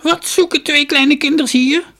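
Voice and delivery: put-on high, loud, creaky old woman's voice; shrill-pitched storybook crone, full voice. A narrator's reading. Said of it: "een kromme oude vrouw".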